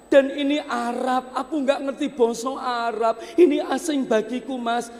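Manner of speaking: high, slightly laughing plea; high pleading, a bit fast; complaining wife. Acted. Wife pleads she knows no Arabic, high.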